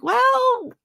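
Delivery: very high, drawn-out "well"; mock-innocent high stall; sheepish hedging. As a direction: stall before admitting it. Retold sheepish admission.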